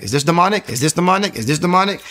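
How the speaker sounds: urgent questioning. Retold or imitated. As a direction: re-enact frantic, repeated questioning. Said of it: "I'm just asking questions like".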